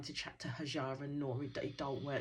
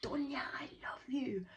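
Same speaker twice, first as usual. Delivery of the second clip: very high, mock lovestruck call; imitated shout back; 11 semitones higher than usual. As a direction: call out her name high, without real volume. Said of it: "shouts back to her".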